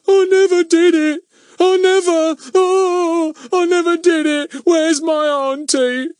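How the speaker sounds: very high, loud wailing voice; groaning ghostly voice. Acted. Fatty throwing a groaning voice to terrify Goon, high loud wail. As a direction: wail it from somewhere unseen. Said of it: "a groaning voice that said".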